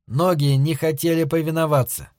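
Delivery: ordinary delivery, nothing marked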